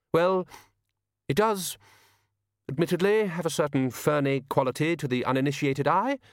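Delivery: high, hesitant, reluctant admission; Jack Frost, defensive. A narrator's reading.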